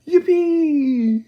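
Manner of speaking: put-on high, loud whooping old man; joyful shout. A narrator's reading. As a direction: whoop with glee like a rocket ride. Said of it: "shouted Grandpa Joe".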